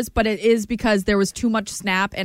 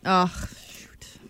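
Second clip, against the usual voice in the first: whispering; 3 semitones lower, 5 dB quieter than usual. Real, not acted. Disappointed muttered reaction.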